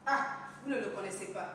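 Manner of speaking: ordinary talk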